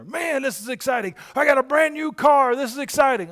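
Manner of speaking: high, loud giddy excitement; mock excitement. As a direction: gush like you just bought a car. Mock buyer of a new car.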